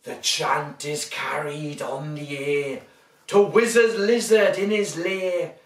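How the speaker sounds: lively storybook reading; reading to children. A narrator's reading. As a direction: read it big for little ones.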